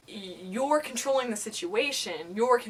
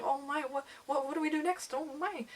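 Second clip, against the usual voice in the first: higher, softer worried little voice; Piglet; 5 semitones higher, 7 dB quieter than usual. Acted. Inner voice worried all the time, high fretful character. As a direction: fret in a small, high voice. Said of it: "Piglet, who's, like, super worried all the time".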